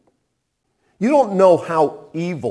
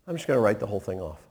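quick, soft and much lower; gentle release; 6 semitones lower, 8 dB quieter, much faster than usual. Acted. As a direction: say it lightly, letting go. Master forgiving the debt.

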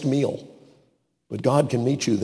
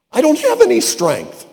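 whining Gideon; 4 dB louder than usual. Acted. Gideon protests to God.

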